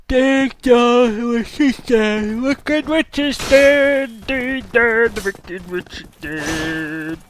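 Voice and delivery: put-on high, slow whiny child voice; tattling kid. Kid tattling on his sister to dad, high and whiny.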